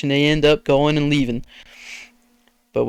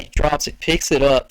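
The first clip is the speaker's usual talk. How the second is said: normal talking voice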